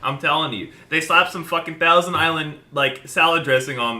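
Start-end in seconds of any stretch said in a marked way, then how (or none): none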